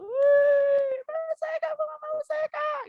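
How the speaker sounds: put-on very high squealing kid voice; eager child. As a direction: squeal like a child begging to be picked. Voices eager children answering her. (suara anak kecil antusias minta dipilih)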